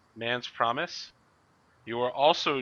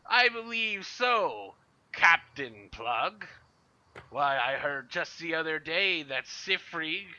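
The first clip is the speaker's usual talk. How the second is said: pirate Scourge voice; 6 semitones higher, 4 dB louder than usual. Acted. Master Scourge answers Captain Plug in a sly pirate voice. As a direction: drag out the words like a scheming pirate. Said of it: "And Master Scourge goes".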